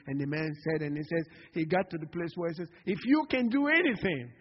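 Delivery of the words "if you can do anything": loud, high-pitched outburst; acted plea. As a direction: cry it out as the pleading father. Acts out desperate father to Jesus.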